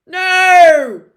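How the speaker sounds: growled. Acted. Hagrid refuses Dumbledore's stepping aside, growling loud. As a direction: snap out a refusal. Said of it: "growled Hagrid".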